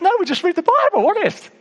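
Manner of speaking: high, insistent retold protest; squeaky-high earnest insistence; protesting innocence. Retells protesting innocence to colleague.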